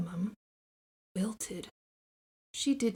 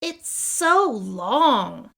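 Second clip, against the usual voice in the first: slow, louder mocking child voice; teasing kid; 2 semitones higher, 7 dB louder, much slower than usual. Classmate mocking her long name, slow and louder. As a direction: drag it out to tease her.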